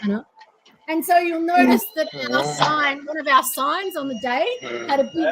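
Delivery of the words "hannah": quick, mildly snarky comeback. Retold or imitated. Friend answering back to patronizing praise, snarky. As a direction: imitate a friend's sarcastic retort, fast. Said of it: "she goes back with her snarky snarkiness".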